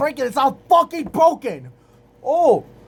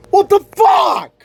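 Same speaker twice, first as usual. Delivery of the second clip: loud, higher, slowed-down outburst; angry shout; 7 semitones higher, 4 dB louder than usual. Real, not acted. Vlogger yelling as the laptop latch fails, angry shout. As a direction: let the frustration explode. Latch pops loose again.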